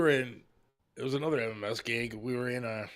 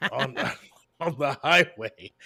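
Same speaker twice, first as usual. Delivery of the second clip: laughing; 2 semitones higher, 5 dB louder, slower than usual. Real, not acted. Laughing at own drunk driving.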